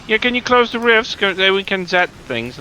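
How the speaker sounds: high mocking whine; mocking other players. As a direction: mimic a lazy player's request. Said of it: "and just like".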